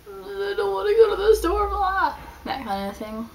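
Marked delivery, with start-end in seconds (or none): 0.1-2.1 high whiny fake sob, hammed-up; mock crying. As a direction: whine it like a sulky kid faking tears. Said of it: "make up stories, and then they act them out".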